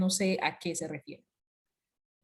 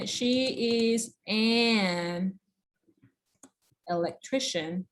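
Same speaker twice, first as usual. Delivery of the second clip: 1 slow model sentence; much slower than usual. Shown as a demonstration. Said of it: "si me dicen".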